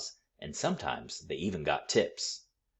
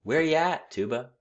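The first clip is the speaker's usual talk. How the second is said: higher and louder call, a little subdued; calling back; 10 semitones higher, 6 dB louder than usual. A narrator's reading. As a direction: call back to a friend across the square. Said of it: "Shorty called back".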